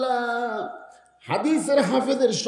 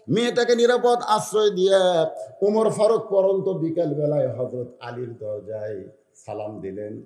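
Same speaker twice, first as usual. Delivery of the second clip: ordinary delivery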